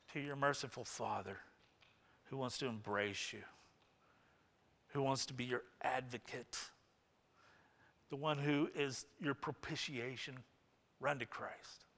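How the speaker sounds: soft, pleading and gentle; tender plea. Real, not acted. Pastor urging the church toward Christ, soft and tender. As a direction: invite them in gently.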